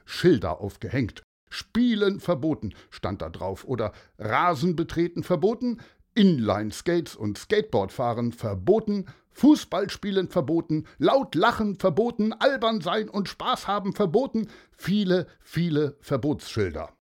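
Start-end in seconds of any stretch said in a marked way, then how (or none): 1.5-2.7 stern sign voice. A narrator's reading. Reading strict prohibition signs. 4.2-16.0 higher, louder, strict official tone; mock-stern prohibitions; stern sign reading. A narrator's reading.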